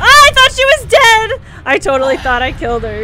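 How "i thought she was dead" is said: high, loud wail of dismay; dismayed yell. Real, not acted. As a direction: cry out in disbelief. Boss is still alive.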